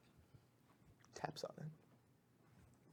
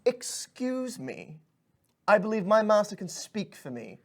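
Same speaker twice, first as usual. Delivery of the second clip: high, slow and formal little pixie; a polite pixie deferring to his master, drawn out; prim pixie; 7 semitones higher than usual. Acted. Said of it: "he's like".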